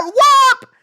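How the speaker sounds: a sudden high, loud whoop. Real, not acted. Punctuating her rant with a loud whoop. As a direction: yelp it out high.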